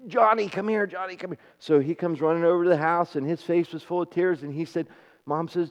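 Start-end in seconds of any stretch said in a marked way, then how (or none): 0.1-1.4 imitated mother's call, higher and held down; hushed mock calling, repeated urgently. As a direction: lightly act out an anxious summons. Mother calling her boy home.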